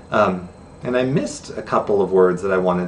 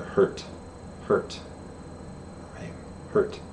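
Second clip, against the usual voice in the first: soft demo word; 10 dB quieter, slower than usual. Shown as a demonstration. Says sign name while demonstrating.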